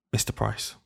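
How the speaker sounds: put-on voiceless whisper of a boy's name. Shy boy whispering to his teacher, hushed. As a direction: breathe it out like a shy pupil. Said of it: "he said".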